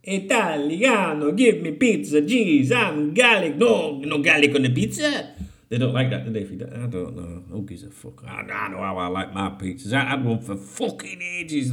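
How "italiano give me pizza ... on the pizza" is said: slow, hammed-up Italian accent; a caricature Italian accent, drawn out; mock Italian accent. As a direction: play a cartoon Italian ordering pizza. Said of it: "That's how to speak".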